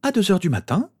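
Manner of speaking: high, incredulous stern question. A narrator's reading. Mother to son out at night, stern and high-pitched. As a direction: challenge it sharply on a high pitch. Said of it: "d'une voix sévère".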